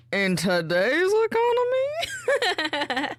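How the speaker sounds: high, loud incredulous squawk, half laughing; mock disbelief. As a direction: squeal it in comic disbelief, slowly. Mock disbelief joke, breaking into laughter.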